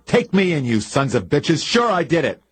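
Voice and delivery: shouted high and loud in anguish; furious, desperate shouting at the sheriff; wild outburst. A narrator's reading. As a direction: yell it out, a broken man confessing. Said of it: "Then Bill Chess said wildly".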